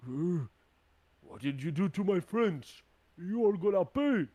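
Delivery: loud, high, indignant giant; angry threat. Acted. Giant to the attacker of its friends, angry shouted threat. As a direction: shout a vow of revenge.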